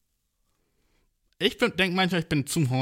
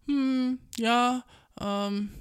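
imitating a coworker's much higher, squeaky phone voice; ultra high voice; 8 semitones higher, 4 dB quieter, much slower than usual. Coworker on the phone to his girlfriend, ultra high voice. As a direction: go way up high and soft, mock-sweet. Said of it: "Also ultra hohe Stimme".

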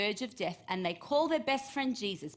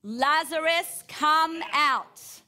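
slow, much higher and louder command, drawn-out name; loud calling out; 6 semitones higher, 8 dB louder, much slower than usual. Acted. Preacher voicing Jesus calling Lazarus from the tomb, loud slow call. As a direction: call the dead man out of the tomb with full voice. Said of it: "he called out in a loud voice".

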